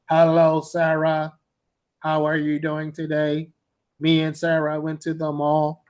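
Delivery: monotone robotic text-to-speech reading; computer-generated voice. Retold or imitated. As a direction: imitate a computer-generated recording, every syllable level. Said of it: "recordings that sound like a computer".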